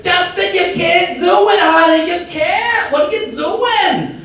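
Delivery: high, drawn-out mock Bronx nag; little naysayer voice. Inner voice telling Justin he can't, high mocking nag. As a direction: put on a funny high-pitched accent to nag. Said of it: "that little voice that says".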